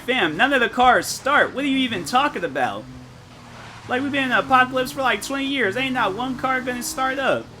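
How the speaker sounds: high, fast, exasperated disbelief; exasperated outburst. Retold or imitated. Scolding Wholesome that no car will start, exasperated. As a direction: rattle it off high and fed up, like it's obvious. Scolding friend in zombie scenario.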